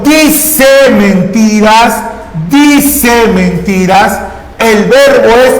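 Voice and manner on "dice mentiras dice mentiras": "dice mentiras" said slowly and deliberately, word by word; slow teacher demo. Shown as a demonstration. Teacher to students, slowly modelling the example sentence. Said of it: "teacher demonstrates the sentence".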